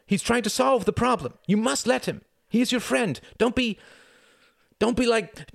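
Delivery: high, loud, frantic shouting; desperate shouted plea. A narrator's reading. Tom begging the men to spare his brother, shouting. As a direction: plead at the top of your voice, rushing the end. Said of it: "shouted Tom, willing himself to stop struggling".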